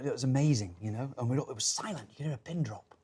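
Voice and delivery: ordinary talk, nothing marked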